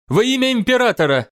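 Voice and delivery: rally the fleet proudly; battle cry. Acted.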